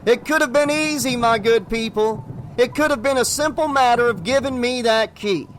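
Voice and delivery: high, ringing villain's shout; a showman's shout at the window. Acted. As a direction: call out to the whole house, mocking. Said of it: "the salesman shouted".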